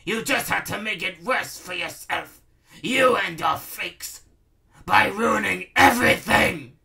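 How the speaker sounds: high rant building to a loud outburst; angry ranting. A narrator's reading. Baron blaming Princess Kitty and her freaks, ranting angrily. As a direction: rage at the one who ruined it. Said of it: "he ranted angrily".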